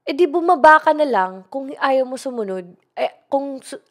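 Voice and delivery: angry driver. Retold or imitated. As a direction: retell his outburst with real heat. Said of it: "tinaasan niya ako ng boses". (galit na drayber na sumisigaw)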